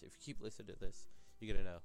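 a sudden loud punch on the line; loud tease. Real, not acted. Podcaster teasing listeners, loud. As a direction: blurt it out loud.

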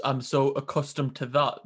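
ordinary talk

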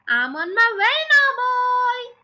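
high, loud call from above. A narrator's reading. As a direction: call down triumphantly to the boy. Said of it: "she called down to George".

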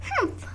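sulky little nose snort. A narrator's reading. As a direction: huff like a cross child. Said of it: "she snorted".

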